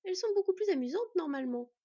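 lively tease, high and loud at first; teasing. A narrator's reading. Young man needling the girl behind him, teasing. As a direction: tease playfully, starting up high. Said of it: "continua-t-il de la taquiner".

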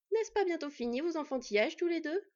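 exasperated wolf scolding her brothers. Acted. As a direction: tell off two brawling boys. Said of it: "gronda-t-elle".

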